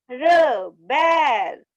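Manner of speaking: "बैर" stretched very slowly in arched tune; joined word. Shown as a demonstration. Teacher leading the class along, "बैर" drawn out. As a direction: call out "बैर" long and blended. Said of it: "मेरे साथ साथ आप फिर से बोलिए".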